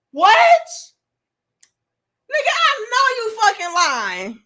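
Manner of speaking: loud, high-pitched incredulous outburst; mock-scandalized shock; outraged disbelief. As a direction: blurt it like you can't believe it.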